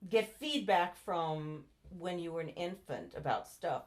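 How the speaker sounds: ordinary delivery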